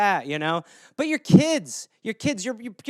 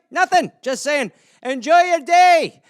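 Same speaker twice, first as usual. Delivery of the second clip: high, loud Scottish send-off; Scottish accent, pitched up; Scottish guy; 6 semitones higher, 5 dB louder than usual. Acted. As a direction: call out a breezy goodbye as a Scot. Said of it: "the Scottish guy".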